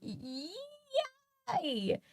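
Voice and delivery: high, soft yay through a laugh; laughing cheer. Real, not acted. Streamer giggling at the car noise, excited.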